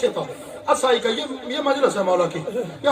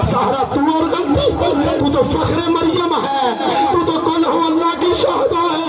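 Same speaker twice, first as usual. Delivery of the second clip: weeping lament; 6 semitones higher than usual. A narrator's reading. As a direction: draw each word out in sobbing grief. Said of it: "मातम करके रो के कहा".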